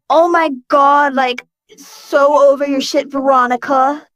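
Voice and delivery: slow, drawn-out mock Valley Girl; hammed-up Valley Girl with stretched vowels; bad Valley Girl accent. Man striking a pose for fun, bad Valley Girl accent. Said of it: "said in a shitty Valley Girl accent".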